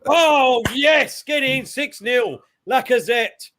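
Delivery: high-pitched, excited cheer; elated football fan's cry; goal celebration. Real, not acted.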